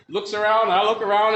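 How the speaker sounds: usual speaking voice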